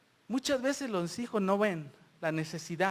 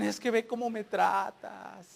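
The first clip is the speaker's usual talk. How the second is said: slow, drawn-out grievance; mock complaining child; 4 dB quieter, slower than usual. Children who don't want to obey, mock slow complaint. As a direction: imitate a child's long-suffering complaint.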